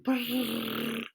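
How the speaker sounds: a rolled brrr like a ringing phone; phone ring purr. Retold or imitated. Reader imitating the phone ringing in mild mock purr. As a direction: purr it like a distant ringtone. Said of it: "I hear the purr of the phone ring".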